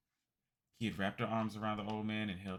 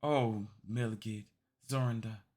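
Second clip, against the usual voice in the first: put-on louder, hopeful old voice; eager old man; 4 semitones higher, 6 dB louder than usual. Old man welcoming his returned allies, excited. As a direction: greet old friends with sudden joy.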